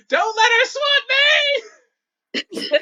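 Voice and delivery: very high, loud panicked shriek; Princess screaming. Acted. Princess fleeing a fly swatter, screaming. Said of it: "Princess screaming the entire time".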